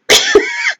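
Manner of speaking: short high incredulous laugh; a quick amused laugh; real amused giggle. Real, not acted. Laughing at an absurd claim.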